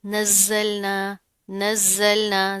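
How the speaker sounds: नज़्ज़लना with the z held firmly; hard doubled z. Shown as a demonstration. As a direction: slowly press into the buzzing zz of नज़्ज़लना. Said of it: "सख्ती से जमा कर पढ़ेंगे". (नज़्ज़लना, ज़ा सख्ती से जमा कर)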